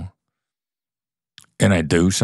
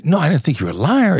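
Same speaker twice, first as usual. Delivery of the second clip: eager protest; 9 semitones higher, faster than usual. Retold or imitated. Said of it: "you might say".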